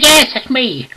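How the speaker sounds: high, loud announcement; hammed-up eager answer; eager phone reply.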